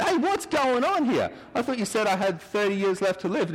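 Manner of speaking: high, indignant protest at God; indignant surprise. Retold or imitated. Woman back from the dead protesting to God, high and indignant. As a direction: pitch it up, outraged she was cheated.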